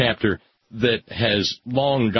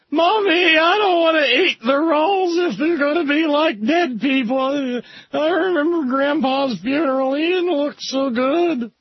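put-on very high-pitched whiny kid on the verge of tears; scared little child; 3 dB louder than usual. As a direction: play a frightened small child begging mommy. Child voice, scared of dead people.

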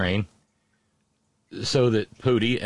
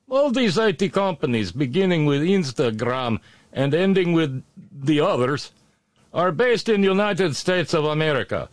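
put-on Russian accent; 8 semitones higher than usual. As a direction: ham up a sketchy Russian accent. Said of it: "dumb accent".

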